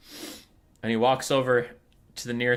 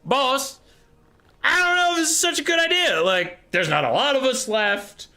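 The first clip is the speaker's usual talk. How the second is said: high, anxious henchman voice; worried warlock engineer; 7 semitones higher, 3 dB louder than usual. Acted. Warlock engineer friend objecting to the boss, high-pitched worry. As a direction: plead nervously in a high voice. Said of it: "his warlock engineer friend goes".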